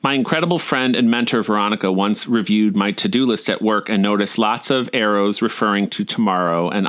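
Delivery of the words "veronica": ordinary delivery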